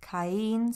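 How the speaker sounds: Caín split into two syllables, leaning on the í. Shown as a demonstration. Teacher showing learners the accented Caín, two syllables. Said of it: "There are those accents again".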